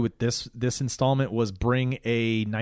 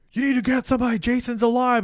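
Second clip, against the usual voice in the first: mock urgent plea; 10 semitones higher than usual. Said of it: "He's like".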